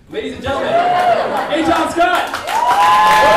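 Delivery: announcer intro. Real, not acted. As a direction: hype up the next comic's name, stretching it out. Host introducing the next comedian.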